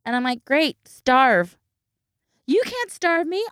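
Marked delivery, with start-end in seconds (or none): 0.4-1.6 loud, higher, curt retort; retold fed-up dismissal. Mother dismisses picky eater, retold. 2.5-3.4 high-pitched protest; imitated indignant child. Child protests to mother, retold.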